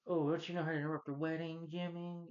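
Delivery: mock villain. Reviewer quoting the wedding villain, mock taunting voice. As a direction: imitate a taunting movie villain. Imitating film villain's line.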